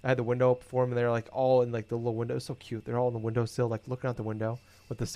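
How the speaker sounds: usual speaking voice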